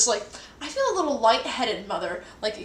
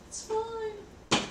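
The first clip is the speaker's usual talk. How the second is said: very high, softer squeaky reassurance; 12 semitones higher, 3 dB quieter than usual. Retold or imitated. Assuring mom it's fine, very high squeak. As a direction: squeak it out like a fib. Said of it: "I'm like".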